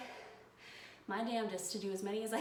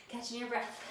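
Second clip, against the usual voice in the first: usual speaking voice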